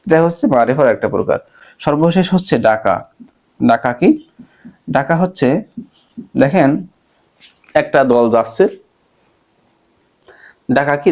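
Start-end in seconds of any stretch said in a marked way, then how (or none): none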